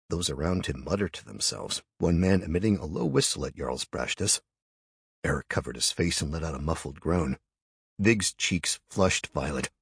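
usual speaking voice

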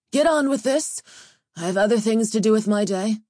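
brusque and impatient, quickening; impatient order. A narrator's reading. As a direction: snap at them to hurry up. Impatient emissary orders the show on.

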